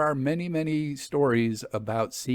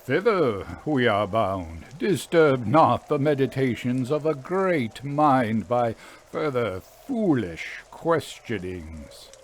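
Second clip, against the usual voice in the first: slow, mock-grand proclamation; a hint of a self-important sage; lightly voiced pompous orator; 3 dB louder than usual. A narrator's reading. Harry teasing his friend, hamming up a lofty sage.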